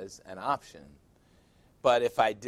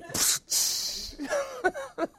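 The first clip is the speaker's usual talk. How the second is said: real laugh. Real, not acted. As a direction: crack up laughing. Laughs again at the joke.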